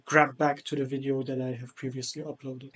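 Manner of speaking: ordinary delivery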